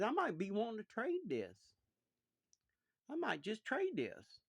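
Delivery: put-on boy luring his sisters into a trade; teasing boy. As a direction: dangle the offer to his sisters.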